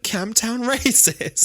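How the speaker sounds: high, drawn-out mock disbelief; incredulous Doctor. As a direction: stretch it out, hammed-up and incredulous. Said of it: "he, like, stops and he'll be like".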